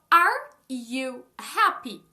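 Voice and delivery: very slow and loud, each word separate; word-by-word demo. Shown as a demonstration. Teacher showing learners the inverted question, slow word-by-word. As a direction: spell out the question word by word, loudly.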